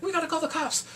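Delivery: high-pitched, urgent put-on voice; mock urgency. Eugene hears of the kidnapping, high urgent plea. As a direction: raise the pitch in alarm. Character urges police after kidnapping.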